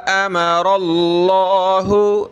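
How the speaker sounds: अमरल्लाहु with stretched मा and full heavy अल्लाह; long mim, heavy Allah. Shown as a demonstration. Teacher showing students a drawn mim and heavy Allah. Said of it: "खींचेंगे और लफ्ज़ अल्लाह को मोटा करेंगे".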